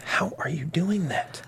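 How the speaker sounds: urgent whisper. Acted. Husband whispering to wife on plane. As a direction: hiss it so no one hears.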